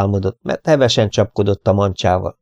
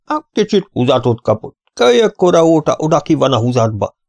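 a hint of old man, pitched up; old man explaining, voice lifted high; lightly voiced old man; 7 semitones higher than usual. A narrator's reading. (enyhén öreges, magas hangon)

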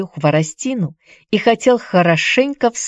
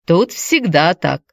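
simple-hearted. A narrator's reading. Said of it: "простодушно отозвался Петер".

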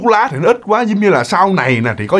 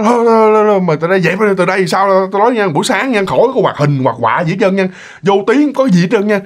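a parent laying down the law, raised; mock scolding parent; 4 semitones higher than usual. Parent punishes the daughter by banning cartoons, mock angry.

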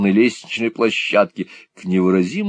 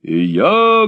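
faithful servant; 9 semitones higher, 4 dB louder than usual. A narrator's reading. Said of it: "говорит верный слуга".

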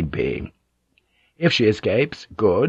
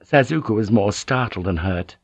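ordinary delivery, nothing marked

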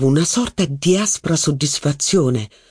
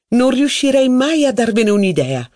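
higher, lively society duchess; a duchess holding court, higher; haughty duchess; 9 semitones higher than usual. Acted. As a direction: gossip grandly about her cousin's wife. Duchess voiced in reading.

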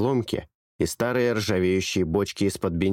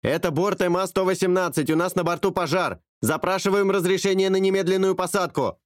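higher, louder pilot mayday; louder radio call; 10 semitones higher than usual. A narrator's reading. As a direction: shout the emergency into the radio. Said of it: "Голос пилота вдруг стал громче". (громче, пилот кричит в рацию)

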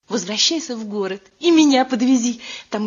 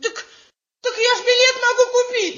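whining fox; 12 semitones higher than usual. Acted. Fox whines high.

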